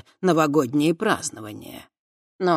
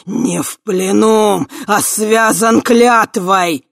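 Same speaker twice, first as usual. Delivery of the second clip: slow, high, very loud roar; huge fiery dragon roar; 6 semitones higher, 11 dB louder, much slower than usual. A narrator's reading. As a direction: bellow it like an enraged dragon. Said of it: "взревел дракон". (яростный рёв дракона)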